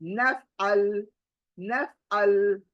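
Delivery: नफअल with a catch in the throat before अल; throaty ain in नफअल. Shown as a demonstration. Teacher shows नफअल: throat catch before अल. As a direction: break नफअल at the throat, finger on the throat. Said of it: "हलक के पर finger".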